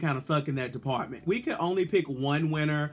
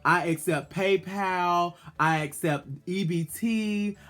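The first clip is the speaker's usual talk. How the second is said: sassy diva voice; 2 semitones higher than usual. Retold or imitated. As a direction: demand the prize money like a diva asking for payment. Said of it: "Adore should have been like".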